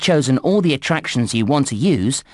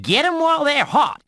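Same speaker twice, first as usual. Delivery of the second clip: hollering cowboy; 7 semitones higher than usual. Acted. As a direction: whoop it up, rounding up the cowpokes. Plays Wild West cowboy announcer.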